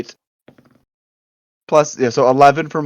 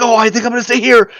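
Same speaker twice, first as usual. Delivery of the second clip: panicking; 7 semitones higher, 3 dB louder, much faster than usual. Acted. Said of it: "panicking because now he's".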